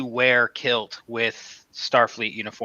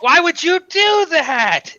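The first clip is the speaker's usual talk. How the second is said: much higher, loud and slow whine; mock anguished Joker; 5 dB louder, slower than usual. Fan voicing the Joker scolding Scarecrow, high slow whine. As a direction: wail it in cartoon despair. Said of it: "he's like".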